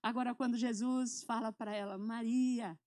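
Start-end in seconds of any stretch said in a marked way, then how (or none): 2.0-2.8 tender call. Retold or imitated. Jesus gently calling Mary by name at the tomb, tender.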